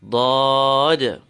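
dad with the ah pulled long; extended letter sound. Shown as a demonstration. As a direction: sustain the back ah in dad. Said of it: "speaking of the extension".

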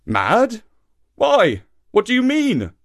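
high, startled questions; crying out. A narrator's reading. Shark baffled by monkey's question, crying out. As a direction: cry it out, baffled. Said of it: "cried Papa".

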